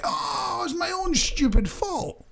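high, slow, sheepish mock victim voice; imitating a sheepish staff member, high and slow. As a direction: put on a high embarrassed voice, dragging it out. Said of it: "I go".

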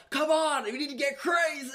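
mock a rapper pleading with a dead crowd; mock hype man. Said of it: "And they're like".

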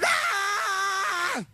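a high, strange cry; demon scream. Retold or imitated. Preacher acting the demon screaming as it leaves. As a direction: scream as the thing is cast out. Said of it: "That thing screamed".

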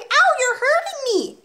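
put-on very high, drawn-out child's wail; hurt little boy crying out; pained cry. A narrator's reading. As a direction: wail in pain at being pinched. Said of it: "Eric cried".

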